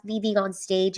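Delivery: ordinary talk, nothing marked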